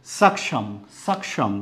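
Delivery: सक्षम with the क्ष leaning to a छ sound; common mispronunciation. Shown as a demonstration. Teacher shows how people normally read it, common pronunciation. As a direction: say सक्षम the everyday way, starting on a plain s. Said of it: "ये छ की आवाज़ दे रहा है".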